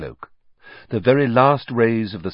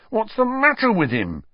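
alarmed; 12 semitones higher than usual. A narrator's reading. Ron alarmed at wriggling rat.

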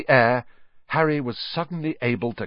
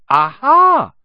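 much higher, much louder burst of discovery; triumphant aha; 14 semitones higher, 12 dB louder than usual. A narrator's reading. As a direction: pounce on the slip. Harry catches a clue.

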